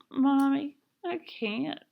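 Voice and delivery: high, soft, tearful plea; sobby. A narrator's reading. Joetta refusing mama's order, sobby and small. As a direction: refuse weakly, near tears. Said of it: "starting to get all sobby".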